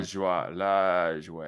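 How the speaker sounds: "Lajoie" drawn out as LAAHZH-way; demonstrated pronunciation. Shown as a demonstration. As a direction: slowly model "Lajoie" with a long first vowel. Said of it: "Lajoie the right way".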